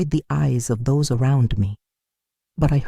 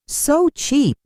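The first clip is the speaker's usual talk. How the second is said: malicious sneer; 12 semitones higher than usual. A narrator's reading. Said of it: "a more malicious comment".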